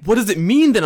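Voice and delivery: put-on high, loud alarmed child; panicked kid. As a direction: ask it like a panicked student. Kids panicking in story.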